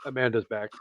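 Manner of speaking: normal talking voice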